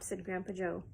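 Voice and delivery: plain reading voice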